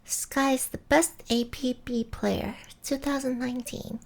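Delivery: high, sing-song anime voice; chirpy cartoon girl delivery; cutesy high voice. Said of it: "I'm just gonna do it".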